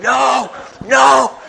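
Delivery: high shouted "No, no!" from bystanders; retold shout. Bystanders warning a lost driver, high mock shout. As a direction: yell it like a stranger waving you down. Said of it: "people on the side shouting".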